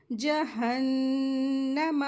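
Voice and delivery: जहन्नम with a held ghunna on the doubled nun and a quick meem; slow recited जहन्नम, nasal nun held, meem short; Quranic recitation. Shown as a demonstration. Teacher demonstrates जहन्नम for the class. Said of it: "मीम को जल्दी पढ़ेंगे".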